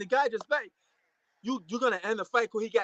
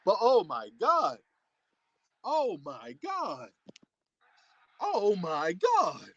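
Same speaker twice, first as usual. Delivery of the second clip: slow, drawn-out astonishment; stunned disbelief; much slower than usual. Real, not acted. Fight fan watching the sudden finish, stunned disbelief. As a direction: stretch each word in real shock.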